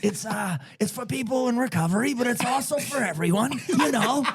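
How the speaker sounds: high-pitched, earnest Boston guy pitch; Boston business guy. Acted. Recovering Boston guy pitching his new nonprofit, high-pitched clipped Boston voice. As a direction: play a Boston guy proudly talking up his venture. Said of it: "that Boston guy on, like, a business tip".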